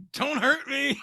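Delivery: high, sudden pleading squeal; mock fear. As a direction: beg in hammed-up terror. Mock pleading after the threats.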